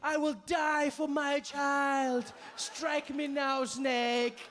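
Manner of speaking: high, slow, melodramatic declamation; heroic mother. Retold or imitated. Mother facing the snake for her child, mock-heroic cry. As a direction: declare it as a hammed-up self-sacrificing mom. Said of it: "a mother would run in without thinking".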